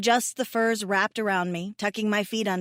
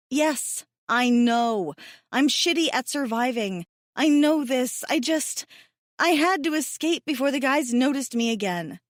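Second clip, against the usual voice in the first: frustrated; 5 semitones higher, 4 dB louder than usual. Acted. Frustrated self-deprecating outburst.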